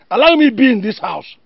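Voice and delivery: loud, high-pitched protest; indignant. Acted. Parent arguing over staying in the house, indignant.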